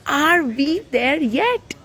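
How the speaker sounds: high, slow drawn-out nagging; a child impatient in the car; whiny kid. A narrator's reading. Putti nagging his parents on the drive, slow whine.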